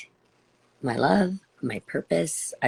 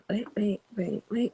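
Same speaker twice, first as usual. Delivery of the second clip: higher breathless whispered wait; excited whisper; 6 semitones higher, 6 dB quieter than usual. Real, not acted. Seeing viewers appear, an eager hushed whisper. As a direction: whisper it as faces pop up.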